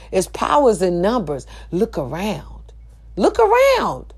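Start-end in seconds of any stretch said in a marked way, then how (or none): 1.7-2.7 softer and slow; hushed, slow look around; quiet, slow. Real, not acted. Quiet then loud repeat. 3.2-4.1 high, sudden call out. Real, not acted. Loud high repeat.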